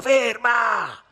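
very loud, high yell; enraged command to stop; furious shout. A narrator's reading. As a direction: bellow it furiously, pitch way up. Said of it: "rifece furibondo".

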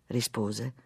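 plain reading voice

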